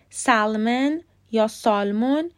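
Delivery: "سالمن یا سالمون" with the L sounded; wrong pronunciations. Shown as a demonstration. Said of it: "هیچ کدوم درست نیستن".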